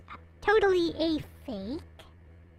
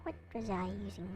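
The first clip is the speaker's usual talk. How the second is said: normal talking voice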